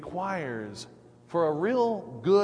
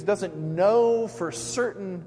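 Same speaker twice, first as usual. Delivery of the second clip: ordinary delivery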